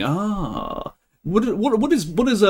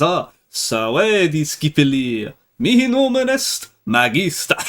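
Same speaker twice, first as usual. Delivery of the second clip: mock Latin video teacher. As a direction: parody an overused beginner Latin video intro. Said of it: "the first thing to say is".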